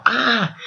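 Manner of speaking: high sharp cry of pain; pained scream. Retold or imitated. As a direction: yelp as if stabbed. Wrestler stabbed in the knee.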